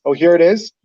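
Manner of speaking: ordinary talk, nothing marked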